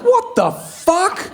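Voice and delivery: mock outraged shout at the producer; incredulous outburst. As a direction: blow up at the silent room in disbelief. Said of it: "I'm like".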